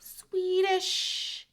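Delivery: high, drawn-out Swedish; playful high-pitched Swedish; mock Swedish. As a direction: stretch Swedish high like a joke. Mock exaggerated pronunciation.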